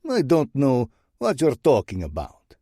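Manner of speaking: put-on feigned innocence in a Russian accent; sly denial. Bratva boss denying the break-in, sly Russian accent. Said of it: "Sidorov's voice is sly".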